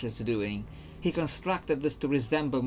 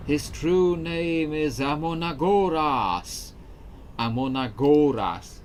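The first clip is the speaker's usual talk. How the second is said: slow, louder, solemn ghost; 4 semitones higher, 8 dB louder, slower than usual. Acted. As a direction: intone it like a spirit. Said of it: "This is my ghost voice".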